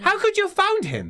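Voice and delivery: high, loud, fast angry shouting; exasperated nephew yelling; furious outburst. Acted. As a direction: explode at her in disbelief.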